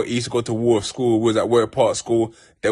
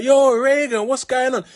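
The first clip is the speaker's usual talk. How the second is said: much higher, bright call of recognition; excited greeting; 13 semitones higher, 3 dB louder, slower than usual. Retold or imitated. Coming downstairs to greet Reagan, excited call. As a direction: hail an old friend warmly, up high. Greeting an old friend at door.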